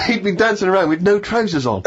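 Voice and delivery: cracking up at the punchline; laughing. Real, not acted. Laughing at own story.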